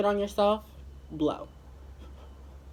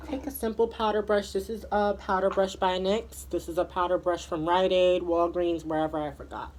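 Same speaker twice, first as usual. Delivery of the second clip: ordinary talk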